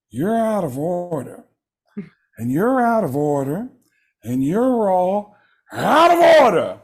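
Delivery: slow, high, drawn-out courtroom outburst; Al Pacino. Acted. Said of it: "doing this one line as Al Pacino".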